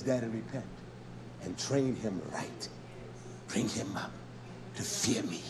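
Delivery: low, soft, spaced-out phrases; hushed divine warning. Acted. God telling a father to train his child, hushed. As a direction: give the warning quietly and deep, pausing between phrases. Voicing God's word to a father.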